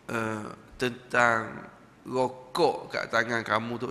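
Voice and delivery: low, slow, solemn voice of the Syekh; stern Syekh. Retold or imitated. Syekh raising the cigarette with the smoker, low and slow.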